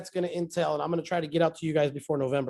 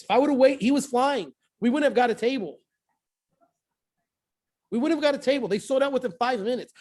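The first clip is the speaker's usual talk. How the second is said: higher, pressing retelling of the rush; a touch of mock urgency telling Gabriel; retold urgency; 6 semitones higher than usual. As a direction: push it like the clock is running out. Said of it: "I'm like".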